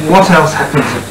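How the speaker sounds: ordinary talk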